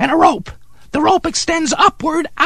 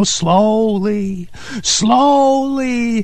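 drawn-out slowly; 4 semitones lower, much slower than usual. Acted. Telling Steve how the mouse gnaws the rope, drawn-out and slow. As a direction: stretch each word out slowly.

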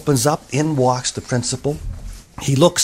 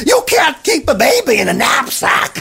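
high, raised exclamation; shocked teacher; 10 semitones higher than usual. A narrator's reading. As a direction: exclaim in alarm as a scandalized teacher. Alarmed teacher exclaims to the boy.

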